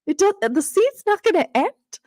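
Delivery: gasping, high-pitched disbelief; retold gasp of surprise, pitch shooting up; mock shock. Reader retelling her reaction to the scene, mock shock. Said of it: "and I was like".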